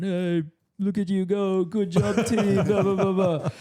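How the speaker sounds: high, sing-song imitation of a cheeky mate; high mock voice of a teasing surfer; mocking Jeremy voice. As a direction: tease it out like a smug rival. Jeremy quoted mocking in comment.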